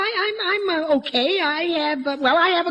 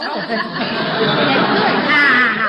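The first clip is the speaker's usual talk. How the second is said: chuckling laugh; 3 dB louder than usual. Acted. Father laughs at nickname.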